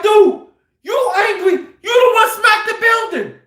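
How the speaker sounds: imitating an accuser's high-pitched yell; retold outraged shout; hollering. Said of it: "I went from hollering back at him".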